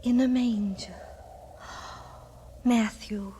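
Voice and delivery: gasp, then breathe his name in a whisper; a hushed, amazed whisper to her husband; awed whisper. Acted. Awed wife learns of the child.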